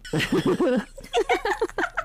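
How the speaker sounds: long high-pitched giggling; high giggle. Real, not acted. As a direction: dissolve into giggles. Laughs at own line.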